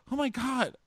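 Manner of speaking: high, frantic hammed-up voice; mock panic. Bachelor panicking about rejection, high frantic mock voice. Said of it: "And then he's like".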